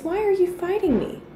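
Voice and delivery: high-pitched challenge, only mildly loud; loud heavenly voice. A narrator's reading. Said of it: "said the loud voice".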